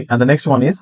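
ordinary delivery

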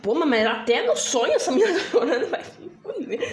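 loud laughing complaint; exasperated laughing rant; laughing exasperation. Real, not acted. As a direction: laugh it out, fed up. Laughing at crying girl.

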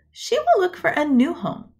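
ordinary delivery

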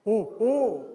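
ordinary delivery

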